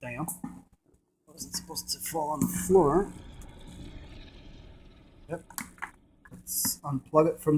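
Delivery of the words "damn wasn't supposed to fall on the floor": ordinary talk, nothing marked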